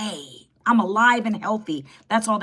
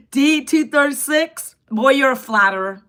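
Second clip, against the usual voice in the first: louder and higher through laughter; laughing; 5 semitones higher, 7 dB louder than usual. Real, not acted. Streamer teasing a flattering viewer, laughing loudly. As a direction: tease the flatterer while still laughing.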